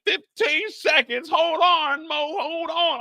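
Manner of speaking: high, slow coach holler; coach shouting. Retold or imitated. Coach calling to choked wrestler Mo, high hollered encouragement. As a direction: yell encouragement across the mat.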